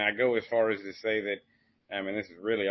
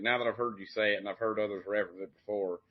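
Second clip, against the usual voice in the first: normal talking voice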